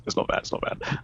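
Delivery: muttered softly, trailing off into a whisper; fading to a whisper. Real, not acted. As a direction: repeat it to yourself, dying away. Repeating the verdict, fading away.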